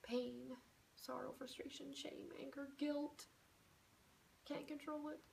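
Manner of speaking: hushed distressed list of emotions; hushed anguish. Shown as a demonstration. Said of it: "and you say".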